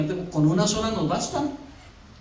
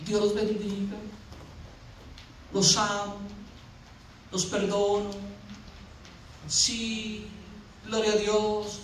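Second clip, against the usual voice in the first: higher, evenly pitched saintly blessing; mock-meek Jesus; 3 semitones higher, 7 dB quieter, slower than usual. Preacher imagining Jesus blessing his mockers, mock-meek. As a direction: parody a meek holy man, each phrase set apart.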